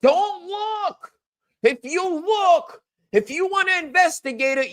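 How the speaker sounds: high mocking voice saying don't look; mocking caricature. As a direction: parody the critics' panic. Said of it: "Remember that?".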